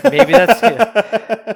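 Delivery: very slow, high and loud through laughter; real laugh-filled aside. Real, not acted. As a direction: get the words out while cracking up. Laughing at own suggestion.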